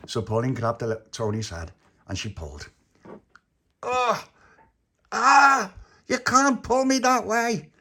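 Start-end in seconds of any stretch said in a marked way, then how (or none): 3.8-5.7 high yelps rising to a loud cry; acted pain cries. Tony as Pauline pulls his head, acted pain cries. 6.1-7.7 high, loud, drawn-out complaint; pained protest. Acted. Tony stuck in the wall to Pauline, pained protest.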